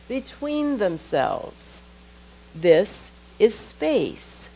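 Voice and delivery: measured reading with long pauses between phrases; deliberate, phrase by phrase, leaving space after each idea; slow reading. Real, not acted. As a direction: read it slowly so it can sink in. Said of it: "I'm gonna read it slowly".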